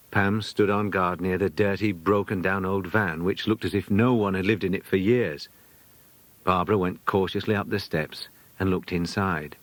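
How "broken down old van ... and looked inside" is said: plain reading voice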